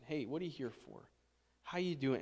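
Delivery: soft, earnest asking; quiet, caring check-in; sincere question. Shown as a demonstration. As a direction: ask it like you truly want to know. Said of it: "And, and really mean it".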